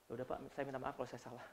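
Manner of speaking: very soft, fast, low reluctant sorry; grudging quick apology. Retold or imitated. Employee rattling off an insincere apology to the boss, very soft and fast. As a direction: rush a sorry you don't mean. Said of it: "nggak tulus kamu minta maaf".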